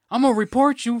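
high, loud mock threat; yelled threat. Retold coworker threatening to report him, yelling.

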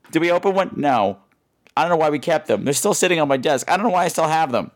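ordinary talk

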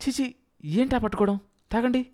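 high-pitched, disgusted telling-off; scolding wife. A narrator's reading. Wife to husband after the spilled drink, scolding. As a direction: scold him for his clumsiness.

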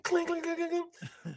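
high, soft clink sound effect; glass clinking. Retold or imitated. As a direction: tinkle like breaking glass. Imitating glass breaking.

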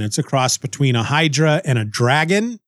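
ordinary delivery

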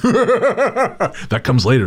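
squeaky high laugh through words. Real, not acted. Laughing, then repeated plain.

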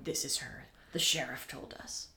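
whispered, low-pitched suspicion; hushed knowing aside. Acted. Store workers eyeing the supposed shoplifter, hushed and knowing. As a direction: imitate staff whispering a secret to each other.

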